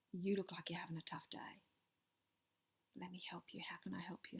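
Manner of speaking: soft, hushed whisper to a distraught stranger; hushed reassurance to a crying mother; gentle whisper. Retold or imitated. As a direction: whisper it kindly, close and calm. Gently consoling hysterical mum in crowd.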